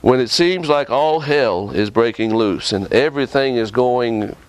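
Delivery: normal talking voice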